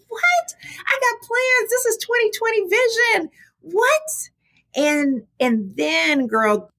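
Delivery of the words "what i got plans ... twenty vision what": high-pitched, exasperated retold outburst; irritated disbelief. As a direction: squeal it out like your plans were just ruined. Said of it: "I was very irritated".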